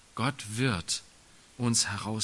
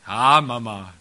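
put-on loud, lower, dutiful groan of a teen; bored son; 3 semitones lower, 11 dB louder than usual. Son brushes off his mother, loud and bored. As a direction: toss off a lazy reply to mum.

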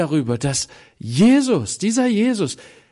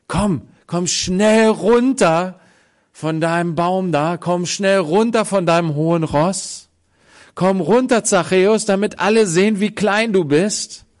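loud, higher, taunting call down from the tree; mocking scold; 6 semitones higher, 6 dB louder than usual. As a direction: taunt him to come down off his high horse. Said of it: "Nein, das sagt er nicht".

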